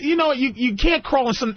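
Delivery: ordinary talk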